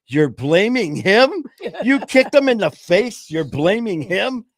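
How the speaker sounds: high, loud, slow disbelief; incredulous. Retold or imitated. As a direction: repeat it in loud disbelief, stretched out. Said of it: "He goes".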